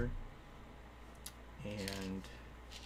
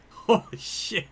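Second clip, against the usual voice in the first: breathless laughing "Holy shit"; slow, airy, laughing through it; real stunned laugh; laughing disbelief; 10 semitones higher, 5 dB louder, slower than usual. Real, not acted.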